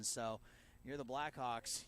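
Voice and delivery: ordinary talk, nothing marked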